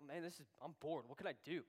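low, quiet bored grumble; bored, talking to himself. Retold or imitated. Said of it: "I'm, I'm bored".

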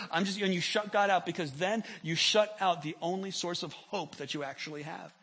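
normal talking voice

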